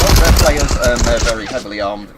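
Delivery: sudden loud alarmed exclamation; startled outburst. Real, not acted. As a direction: blurt it out, caught off guard. Suddenly spots armed enemy players.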